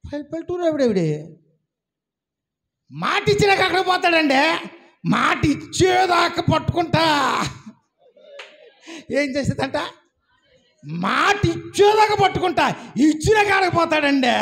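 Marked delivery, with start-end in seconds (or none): 2.9-7.0 loud insistence, a village woman claiming a promise; fervent shout. Retold or imitated. Woman's fierce faith retold. 10.8-14.3 full-force preacher's repetition; preaching shout. Acted. Repeated as preaching point.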